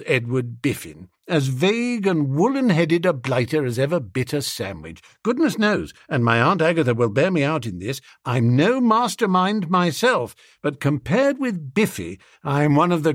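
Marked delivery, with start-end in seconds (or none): none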